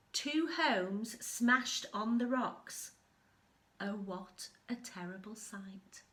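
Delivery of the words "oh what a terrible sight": plain reading voice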